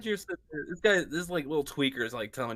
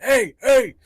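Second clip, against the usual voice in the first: much higher, much louder calling out; calling dwarf; 10 semitones higher, 11 dB louder than usual. Retold or imitated. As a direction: shout to not be left behind. Fat dwarf calling from behind.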